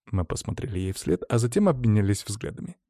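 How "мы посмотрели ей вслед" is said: plain reading voice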